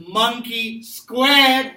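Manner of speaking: "monkey squared" loud and drawn out; stress on monkey squared. Shown as a demonstration. Teacher drills the correct answer into the class, stress on monkey squared. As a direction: stretch "monkey squared" slowly and loudly. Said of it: "emphasize the word monkey".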